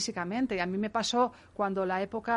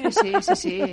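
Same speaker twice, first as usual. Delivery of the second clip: laughing, higher and louder; 7 semitones higher, 6 dB louder, much slower than usual. Real, not acted. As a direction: laugh through the yeses. Laughs at pajama joke.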